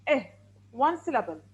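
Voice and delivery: normal talking voice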